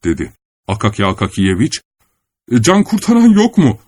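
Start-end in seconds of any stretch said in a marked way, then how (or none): 2.5-3.8 high, loud panicked cry; frightened cry for help. A narrator's reading. Akaki Akakiyeviç, grabbed by robbers, cries for help in panic. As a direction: yelp for help in terror. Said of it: "diye bağıracak oldu".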